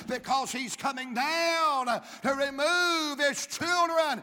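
from a half-sung line building to a high, loud shout; a rising preacher's cry, slow and loud at the peak; preaching at full force. Acted. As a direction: whoop it up into the goodbye.

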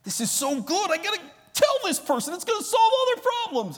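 very high, loud, giddy rush; overexcited inner voice. Retold or imitated. Preacher mocking his own eager witnessing, very high and giddy. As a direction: squeal it like you found the answer. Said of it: "you've thought through it".

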